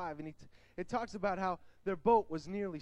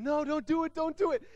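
higher, louder pleading crowd; retold screaming; 8 semitones higher, 6 dB louder than usual. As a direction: beg him to stop, half yelled. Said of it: "they just start screaming".

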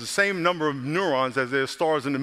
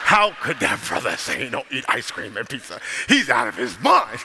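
mock outraged churchgoer; 4 semitones lower than usual. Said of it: "Y'all like".

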